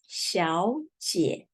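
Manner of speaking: 小姐 said with the first syllable in a rising second tone; tone sandhi 小姐. Shown as a demonstration. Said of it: "So we pronounce".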